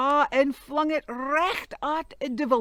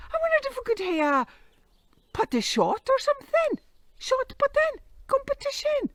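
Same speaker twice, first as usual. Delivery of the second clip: eager devil; 13 semitones higher, 5 dB louder, faster than usual. Acted. Devil proposes a contest.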